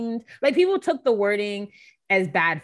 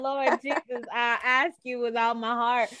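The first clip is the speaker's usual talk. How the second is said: mock-desperate plea; 4 semitones higher than usual. Friend praying against the actor's casting, mock-desperate plea. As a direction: plead to heaven, hammed up.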